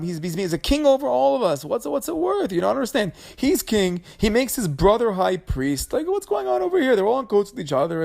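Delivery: a wife stirring up her husband, voiced high and pushy; mock whiny wife. Korach's wife complaining to Korach.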